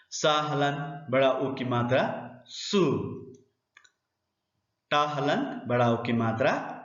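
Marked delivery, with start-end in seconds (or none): 2.5-3.1 सु with a held oo; long vowel. Shown as a demonstration. Teacher sounds out a spelled syllable, long vowel. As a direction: draw out the vowel of सु. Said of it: "उच्चारण थोड़ा सा लंबा होता है".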